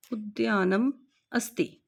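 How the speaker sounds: normal talking voice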